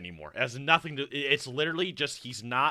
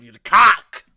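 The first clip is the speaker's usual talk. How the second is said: Cock shouted loud, rising at the end; loud teasing yell; 3 semitones higher, 14 dB louder, slower than usual. Pretend. Streamer teasing the video narrator, loud yell. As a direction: tease him with a big yell.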